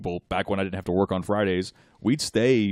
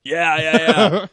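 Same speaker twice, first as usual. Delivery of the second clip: excited; 8 semitones higher, 9 dB louder, much slower than usual. Real, not acted. Excited about the show.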